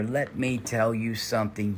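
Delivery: ordinary talk, nothing marked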